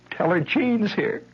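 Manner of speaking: put-on high, slow small-boy voice; shy little boy. Boy at the door asks for Patty, high and slow. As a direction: say it bravely but small.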